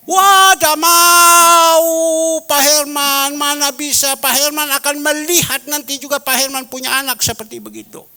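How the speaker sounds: high, loud mock-tearful old woman; imitated crying friend. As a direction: wail like a weeping old friend. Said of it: "sambil dia nangis".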